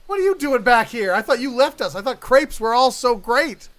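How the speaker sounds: loud, high accusing shout; shocked outburst. Acted. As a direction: unmask the traitor at full volume. Unmasks returning traitor.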